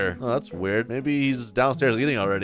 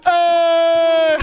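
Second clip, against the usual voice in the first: jump yell; 14 semitones higher, 6 dB louder than usual. Acted. Yelling while jumping off cliff.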